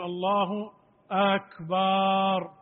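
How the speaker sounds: أكبر chanted with the vowel drawn out very long; stretched akbar. Shown as a demonstration. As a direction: sing أكبر, dragging the vowel. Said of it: "أو يمد لفظ أكبر". (أكبر ممدودة بتطويل)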